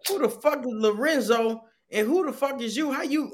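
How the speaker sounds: high, heated demand; angry. Retold or imitated. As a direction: confront him, voice up high. Retold angry confrontation.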